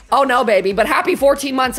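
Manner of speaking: usual speaking voice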